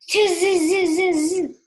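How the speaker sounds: high, loud buzzing "tz-z-z"; monkey chatter. A narrator's reading. Monkeys mimic the scolding peddler, loud mocking chatter. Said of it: "shook their fingers at him and said".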